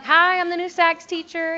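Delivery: high, loud, put-on eager greeting. New teacher greeting the staff, high eager mock cheer. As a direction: sell yourself as friendly and new. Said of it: "trying to say, like".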